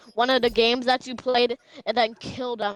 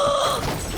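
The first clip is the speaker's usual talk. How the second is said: high sudden intake of breath; sharp excited gasp; 14 semitones higher than usual. Real, not acted. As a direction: gasp at a good find. Sees loot, sharp gasp.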